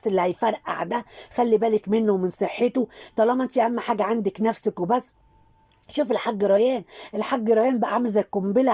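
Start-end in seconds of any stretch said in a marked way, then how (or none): none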